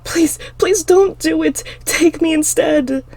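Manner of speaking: high, loud, slow begging scream; desperate pleading. A narrator's reading. Girl begging the killer to spare her sister, pleading. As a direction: plead for her life, breaking. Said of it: "I pleaded".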